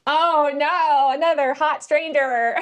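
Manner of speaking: high, loud, hammed-up wail; mock dismay. Reader reacting to another hot stranger, high mock dismay. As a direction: wail in playful pretend alarm.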